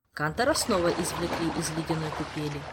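ordinary delivery, nothing marked

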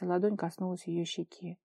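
plain reading voice

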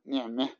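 نعمه with the fatha leaned toward kasra, ni'meh; نعمه ending in e not a; imala on ni'mah. Shown as a demonstration. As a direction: demonstrate Najdi imala on نعمه. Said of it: "فيقولون".

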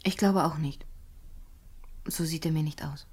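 soft unsure whisper. Acted. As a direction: whisper it, half to herself. Hushed reply about suspect.

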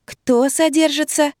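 high, drawn-out baffled question; puzzled. A narrator's reading. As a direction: ask it slowly, not understanding. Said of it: "не поняла я". (недоумённо, высоко и протяжно)

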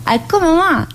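high amused question; surprised. Acted. Inmate asking the nun why, high and surprised. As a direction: pop up in pitch with curiosity.

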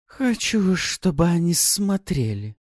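high, slow, sneering; mocking. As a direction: taunt the captives. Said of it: "с издёвкой произнесла".

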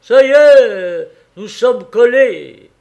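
high, loud, slow shout across the snow. Acted. Fireman calling to the head guard, high loud slow shout. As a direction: yell it out to the guard far off. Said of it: "lui cria à son tour".

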